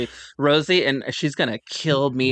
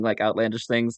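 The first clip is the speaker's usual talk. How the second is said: ordinary talk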